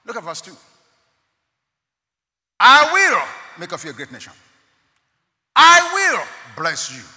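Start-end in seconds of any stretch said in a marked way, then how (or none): 2.6-7.0 loud, commanding reading; voice of God. Acted. Preacher voicing God's promise to Abraham loudly. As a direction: proclaim it as God speaking.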